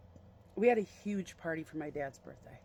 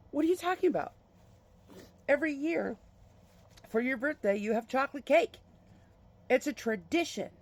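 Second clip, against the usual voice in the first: higher, louder mock-horrified protest; raised-voice retold indignation; horrified mom; 7 semitones higher, 5 dB louder than usual. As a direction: imitate an appalled mother's disbelief.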